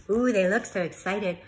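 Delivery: ordinary delivery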